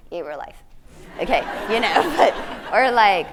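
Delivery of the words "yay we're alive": tired yay. Retold or imitated. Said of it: "is even like".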